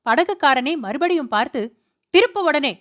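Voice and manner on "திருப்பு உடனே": higher, angry shout; husband shouting at the boatman to turn back. A narrator's reading. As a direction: yell the order across the wind. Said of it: "என்று கத்தினான்".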